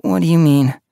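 put-on high young cat voice; curious question. A narrator's reading. Leopard Paw asks her friend, high and puzzled. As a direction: ask it puzzled.

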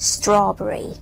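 "strawberry" as STRAW-berry; teacher repeats "strawberry" with English stress; English pronunciation. Shown as a demonstration. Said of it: "ถ้าออกเสียงถูกต้องนะคะ".

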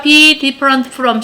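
very slow, drawn-out teacher's model; slow choral-drill delivery; repeat-after-me model. Shown as a demonstration. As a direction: stretch each word for the class to copy. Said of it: "따봐".

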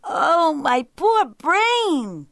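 high, loud, drawn-out lament; theatrical cry. A narrator's reading. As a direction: wail it slowly like an overwhelmed jackal. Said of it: "cried the jackal".

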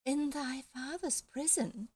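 put-on high, slow, small girlish voice; the parrot posing as a fairy, high and slow; fairy queen voice. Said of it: "small, high voice".

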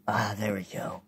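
breathy whisper under the breath. Real, not acted. Whispering while waiting for the bomb part.

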